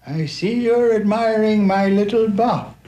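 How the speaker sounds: high, friendly storybook knight; high sustained friendly character voice. Acted. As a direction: pitch it way up, a friendly greeting. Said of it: "said in a friendly tone".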